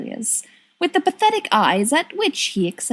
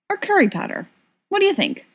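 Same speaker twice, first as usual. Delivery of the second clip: raised pitch, eager; high, bright offer; 11 semitones higher, faster than usual. Acted. Sirius offers a bottle.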